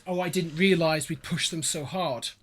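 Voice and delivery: normal talking voice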